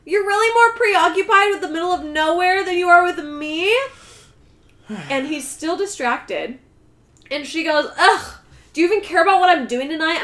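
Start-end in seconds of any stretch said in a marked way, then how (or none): 0.0-3.7 shrieked name, then higher loud complaint; loud whiny girlfriend. Retold or imitated. Girlfriend yelling at distracted Michael, much higher and loud. 8.0-10.2 loud fast higher huff; exasperated girlfriend. Retold or imitated. Girlfriend scolding Michael on the phone, fast and loud.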